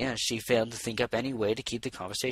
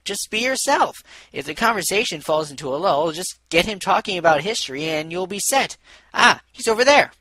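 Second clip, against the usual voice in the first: higher, louder cheerful friend; Lyra's voice; 7 semitones higher, 8 dB louder than usual. Acted. Lyra urging her shy friend on, higher and louder. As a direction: brightly coax a nervous friend along.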